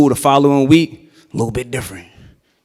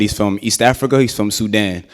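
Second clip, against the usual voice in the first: normal talking voice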